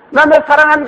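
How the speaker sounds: put-on very high, projected child's call; calling out love. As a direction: holler it to the mountain. Child calls love to mountain.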